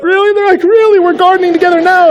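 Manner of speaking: high, loud happy exclamation; excited disbelief. Retold or imitated. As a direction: squeal it with delight. Excited couple on phone.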